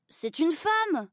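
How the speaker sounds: aigu et lent, indigné; garçon scandalisé. A narrator's reading. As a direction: appuie sur femme, outré. (garçon indigné, voix aiguë et lente)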